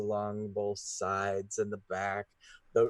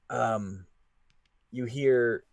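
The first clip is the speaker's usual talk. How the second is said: usual speaking voice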